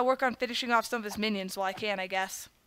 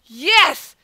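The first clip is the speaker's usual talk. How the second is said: triumphant shout; 3 semitones higher, 7 dB louder than usual. Real, not acted. Defeating the boss.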